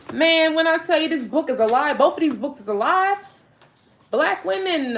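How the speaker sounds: can't contain the thrill; high-pitched thrilled gush; excited, gushing. Real, not acted. Gushing about the books.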